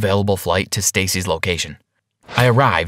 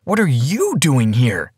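surprised; 3 semitones higher than usual. A narrator's reading. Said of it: "she asked in surprise".

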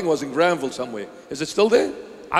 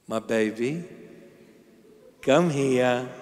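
tender parent; 4 semitones lower than usual. Shown as a demonstration. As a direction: coax the child gently.

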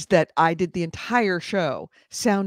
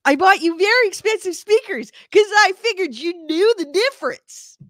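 mock betrayal; 11 semitones higher, 5 dB louder than usual. Mock betrayal over speakers.

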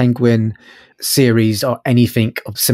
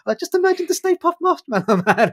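words bubbling through real laughter, pitched up high; laughing. Real, not acted. Podcast host cracking up picturing the Stay Puft Marshmallow Man, laughing. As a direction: giggle through it as the image hits you.

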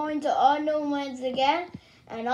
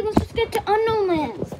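usual speaking voice

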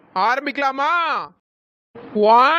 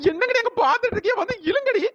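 tearful pleading; 7 semitones higher than usual. Acted. Girl tearfully begs friends to pull.

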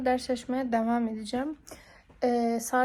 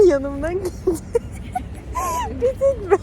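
high squealing laughter; laughing hard; 8 semitones higher, 7 dB louder than usual. Real, not acted. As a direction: laugh through the words. Laughing at friend leaving. (kahkahalarla gülerek)

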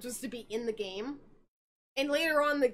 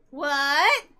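loud laughing what; 7 dB louder than usual. Retold or imitated. Monica reacting to Harry, loud laughing disbelief. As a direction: crack up mid-word. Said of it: "Monica's like".

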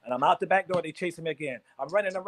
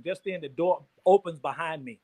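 normal talking voice

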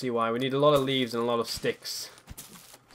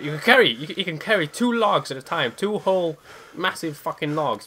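loud, higher-pitched exasperation; exasperated rant; 5 semitones higher, 7 dB louder than usual. Real, not acted. Gamer berating his unfit character for getting winded, exasperated. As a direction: raise your voice in disbelief at the useless character.

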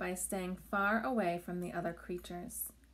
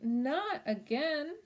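wailing; 7 semitones higher, slower than usual. A narrator's reading. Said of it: "Crickwing wailed".

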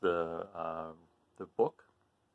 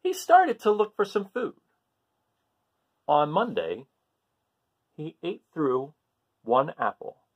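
louder, higher storybook reading, building with each day; animated picture-book narration for a class; reading to children; 9 semitones higher, 8 dB louder than usual. Acted. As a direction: read the list with growing excitement.

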